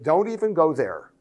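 normal talking voice

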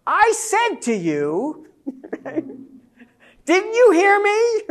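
high, loud and slow, half laughing; exasperated parent scolding the child. Retold or imitated. Preacher mimics parents scolding ignoring children, loud and high. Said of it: "say it with a raised voice".

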